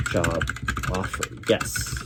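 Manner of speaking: ordinary talk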